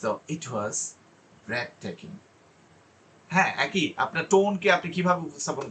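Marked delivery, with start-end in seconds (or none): 0.0-2.2 low, hushed "breathtaking" after a beat; pause, then drop "breathtaking" low and soft; low pitch with pause. Shown as a demonstration. Teacher demonstrating pitch variation, low soft "breathtaking" after a pause. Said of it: "আবার লো পিচে নোটটা নিলে".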